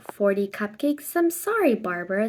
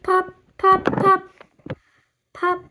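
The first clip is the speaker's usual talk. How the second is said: plain reading voice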